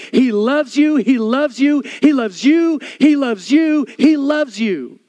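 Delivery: loud, high, insistent preacher's cry; full-force preaching. Acted. Pastor to congregation, preaching loud and high. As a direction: pound home God's love to the crowd.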